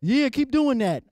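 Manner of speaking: high, loud encouraging call; hype cheer. Retold or imitated. Club guy urging the dancer, high loud call. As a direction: egg the dancer on loudly. Club dancing instructions retold.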